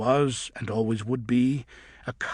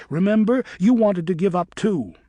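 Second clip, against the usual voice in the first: higher, louder retort; shouting back; 7 semitones higher, 7 dB louder than usual. A narrator's reading. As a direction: fire it straight back at her. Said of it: "Griffin shouted back".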